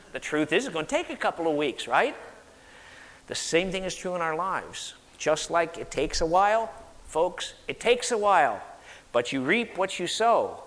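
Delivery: normal talking voice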